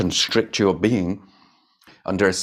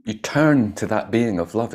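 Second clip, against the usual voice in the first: normal talking voice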